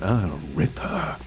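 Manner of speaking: slow, drawn-out "Oh, Ripper"; lightly voiced Ethan taunting. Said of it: "Ethan talking to ... calling him".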